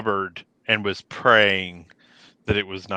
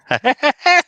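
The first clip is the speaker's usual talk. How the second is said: high, loud cartoon woodpecker cackle; Woody Woodpecker laugh; 6 dB louder than usual. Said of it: "worst Woody, Woody Woodpecker impression".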